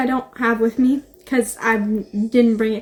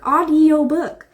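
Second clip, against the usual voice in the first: "audiobook" drawn out slowly; slow, deliberate correction; 3 semitones higher, slower than usual. Real, not acted. Vlogger correcting her own podcast slip, slow and deliberate. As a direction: stretch out "audiobook" to make it stick.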